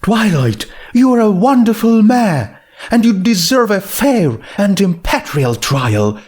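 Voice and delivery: a hint of firm, refined lady; lightly voiced Rarity, insisting. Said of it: "Rarity said firmly".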